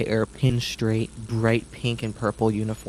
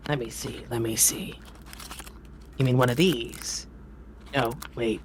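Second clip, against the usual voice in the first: fumbling muttering; 6 dB quieter than usual. Acted. Halfling ringmaster fumbling.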